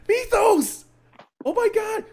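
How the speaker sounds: squealed, play-acted panic; mock distraught cry. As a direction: cry out in hammed-up despair. Re-enacts distraught cry to friend.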